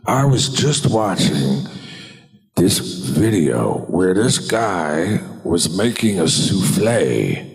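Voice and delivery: slow Pacino drawl; Pacino impression. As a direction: spin it out like Pacino. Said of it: "Al, what have you been doing?".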